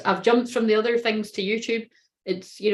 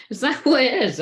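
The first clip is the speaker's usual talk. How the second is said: laughing; 6 semitones higher than usual. Real, not acted. Laughing at her own idea.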